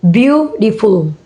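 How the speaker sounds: beautiful split into beau-ti-ful; syllable by syllable. Shown as a demonstration. Teacher showing three syllable word, syllable by syllable. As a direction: separate the beats of beautiful. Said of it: "three syllable words".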